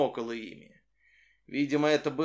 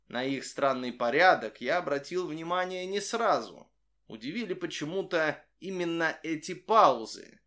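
plain reading voice

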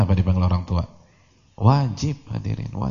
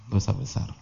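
much softer, sober restatement; quiet repeat; 8 dB quieter than usual. Real, not acted. Preacher repeats the verdict to listeners, quietly. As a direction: drop the volume and let it sink in.

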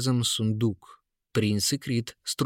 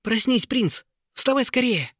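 put-on excited boy; 12 semitones higher, 4 dB louder than usual. A narrator's reading. Boy waking prince urgently.

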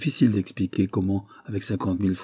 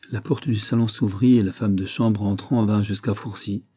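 plain reading voice

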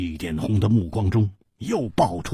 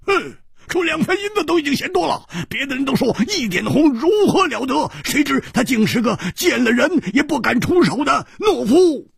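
high, fast scornful tirade; sneering taunt; 6 dB louder, faster than usual. A narrator's reading. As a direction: jeer rapidly, building to a loud last word. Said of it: "冷笑着说". (冷笑着高声讥骂)

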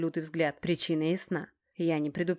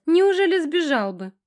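high, loud, drawn-out taunt; slow, ringing challenge pitched way up; 11 semitones higher, 8 dB louder than usual. A narrator's reading. Наташа challenging Геныч, ringing and high. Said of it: "звонкий Наташкин голос".

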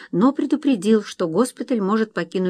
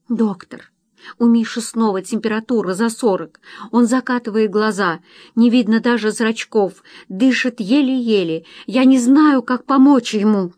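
higher, urgent, building to loud desperation; panicked plea; 4 semitones higher, 5 dB louder than usual. A narrator's reading. Wife begging the doctor to help feverish Misha, panicked. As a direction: plead for help with rising alarm.

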